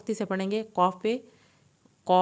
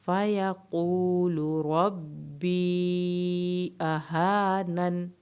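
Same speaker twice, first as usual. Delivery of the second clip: slow, drawn-out melodic tajweed recitation; Quran recitation; much slower than usual. Shown as a demonstration. Teacher reciting the verse for students, slow melodic recitation. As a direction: chant the verse slowly, stretching every long vowel.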